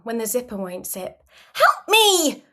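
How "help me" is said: put-on high, loud little-kid demand; sharp, high-pitched childish outburst; snapping child. A narrator's reading. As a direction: snap it out like a cross small child. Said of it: "You snap".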